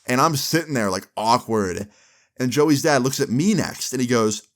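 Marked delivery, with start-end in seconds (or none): none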